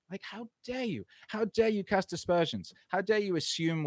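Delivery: how dare you, fuming; indignant. Real, not acted. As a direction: throw the accusation back. Indignant rant at critics.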